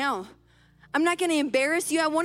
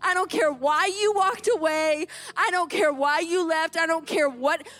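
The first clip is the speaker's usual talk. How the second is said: put-on emphatic, emotional outpouring to a child; impassioned reassurance; 6 semitones higher than usual. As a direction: pour out relieved love, reenacting the reunion. Mother embraces found child, overcome.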